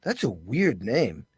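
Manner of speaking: a dry 'good luck' to a stranger's band; dismissive. Retold or imitated. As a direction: shrug it off, unimpressed. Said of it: "I went".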